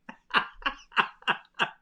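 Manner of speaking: high wheezing laughter; breathless laugh. Real, not acted. Cracking up over plain tea. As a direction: laugh till breath runs out.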